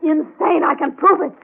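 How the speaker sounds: frantic denial, voice climbing; desperate protest. Acted. Laura pleads with Tony that she is not insane, desperate and rising.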